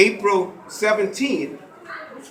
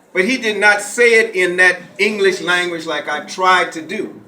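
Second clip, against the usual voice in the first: ordinary delivery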